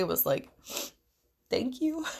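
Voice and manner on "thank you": small high-pitched thank you, as if through tears; imitated tearful thanks. Employee thanking boss through tears on the phone, tearful. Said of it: "through tears, the only thing I could say".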